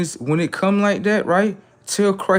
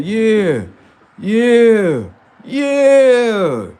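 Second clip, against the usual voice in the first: drawn-out yeahs, building higher and louder; gloating cheer; 4 semitones higher, 5 dB louder, much slower than usual. Acted. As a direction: egg it on with growing relish. Acts himself gloating at the punishment.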